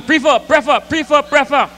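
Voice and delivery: the two pronunciations traded back and forth in a high, heated voice; mock argument.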